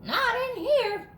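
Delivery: a lightly voiced curious dog; hint of a puzzled pup; dog thinking aloud. A narrator's reading. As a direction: muse to yourself as the dog. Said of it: "he thought".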